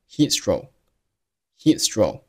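slow "heat stroke", final k held silent; "stroke" ending on an unreleased k. Shown as a demonstration. Teacher slowly showing the k dropped in "stroke". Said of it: "就读成了heat stroke".

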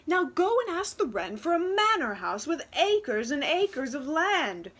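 loud, high commanding mother; ordering. Acted. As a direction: bark the demand at her daughter. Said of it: "her mother ordered".

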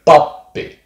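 pappi with a catch between the p's; double p. Shown as a demonstration. Teacher stressing two p's together in pappi. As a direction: say pappi as pap-pi with a tiny pause. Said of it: "Two P's together".

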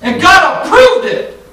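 high, loud, slow preacher shout; preaching at full force. Acted. Preacher defending a prophet's mockery, full-force shouting. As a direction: thunder it at the congregation.

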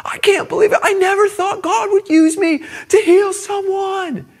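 imitating a weeping boy, high and loud; tearful amazement. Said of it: "He starts weeping and said".

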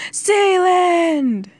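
high, loud drawn-out cry of the name; long anguished shout; desperate yell. A narrator's reading. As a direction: yell it out, falling away at the end. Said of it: "he yelled".

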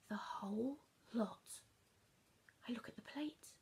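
stunned whisper. A narrator's reading. Shocked realisation.

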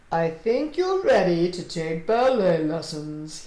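low, slow fatherly voice; kindly. A narrator's reading. Father giving his daughter a ballet gift, kindly. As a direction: offer it kindly, drawing the words out. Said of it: "smiled at her kindly".

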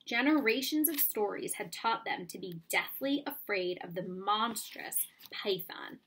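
usual speaking voice

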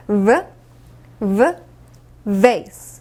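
"V, V, vase" with v as a bare buzz; slow v drill. Shown as a demonstration. Teacher repeating for students, slow sounded-out "V, V, vase". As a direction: separate each buzzing v, then say "vase". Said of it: "천천히 한번 따라 읽어볼게요".

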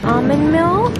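normal talking voice

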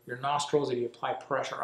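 speaking through cupped fingers; muffled behind hand. Real, not acted. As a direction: hand pressed over mouth. Seen 0: Speaker on left cups hand over nose and mouth while explaining.

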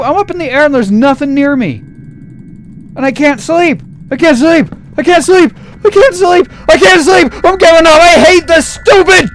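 high, loud furious rant that keeps climbing; frustrated yelling. Real, not acted. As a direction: yell at the game, fed up and near tears. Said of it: "I'm getting kinda mad now".

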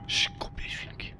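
hushed, voiceless whisper. Real, not acted. Gamer hiding from the pigs, whispering an insult. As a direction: whisper the insult under your breath.

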